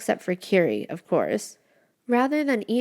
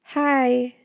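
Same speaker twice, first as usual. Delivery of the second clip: nervous; 6 semitones higher, 5 dB louder than usual. A narrator's reading. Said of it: "with a nervous smile".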